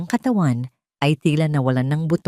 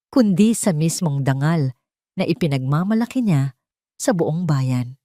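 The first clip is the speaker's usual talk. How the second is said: usual speaking voice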